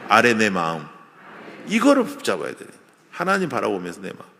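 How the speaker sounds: normal talking voice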